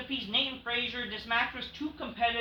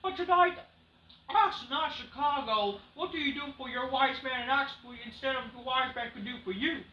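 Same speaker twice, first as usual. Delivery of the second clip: high, mock-grand Heyman voice; lightly hammed Paul Heyman impression; imitating Paul Heyman; 5 semitones higher than usual. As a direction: ham up the smug manager pitch. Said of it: "Paul Heyman like".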